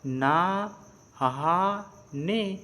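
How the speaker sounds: sounding out. Shown as a demonstration. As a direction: give "ना, हा, ने" as separate teaching syllables. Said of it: "तो क्या हो गया".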